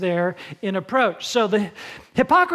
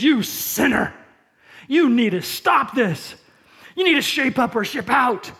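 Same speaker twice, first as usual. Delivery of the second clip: stern, preachy rebuke, louder and higher; mock angry scolding; 3 semitones higher, 5 dB louder than usual. Preacher mocks the angry hypocrite scolding a sinner, stern and loud. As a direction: wag a finger at the sinner. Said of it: "The angry face mask hypocrite says".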